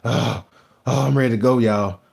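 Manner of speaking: tired moaning imitation; mock worn out. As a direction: whine like you're done for the day. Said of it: "I supposed to been the one be like".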